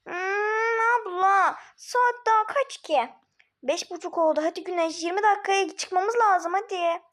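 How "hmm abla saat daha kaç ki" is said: put-on a higher whiny little-girl voice; half-crying sleepy kid; whiny sleepy child. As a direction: whine like a child dragged out of bed.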